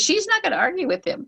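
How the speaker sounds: ordinary talk, nothing marked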